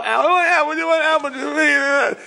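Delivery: very high, drawn-out mock wail; toddler crying fit. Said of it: "crying and screaming".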